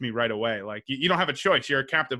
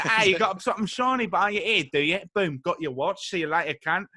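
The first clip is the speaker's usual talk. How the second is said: higher-pitched taunting Cockney street thief; British pickpocket; 4 semitones higher than usual. Acted. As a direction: taunt the mark as a British hooligan. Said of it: "I picture him like a".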